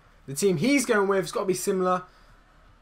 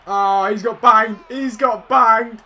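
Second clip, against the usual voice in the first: gloating cheer; 3 semitones higher, 6 dB louder, slower than usual. Real, not acted. Gloating over opponent's miss.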